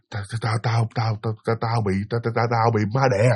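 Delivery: stammering low and soft, terrified; scared stammer. Retold or imitated. Friend stammering to Trâm that a ghost held her, scared. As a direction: stutter the words out in fright. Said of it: "nó sợ mà nó cà lâm luôn".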